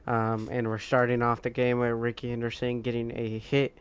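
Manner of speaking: ordinary delivery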